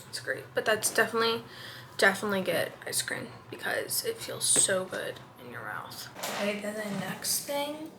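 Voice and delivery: hushed, close to the mic; quiet night voice. Real, not acted. Quiet night routine talk.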